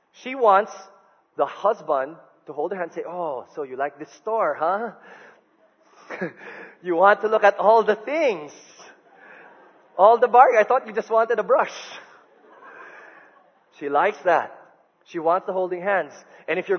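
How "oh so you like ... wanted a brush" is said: playful teasing. Retold or imitated. Husband teasing wife in mall.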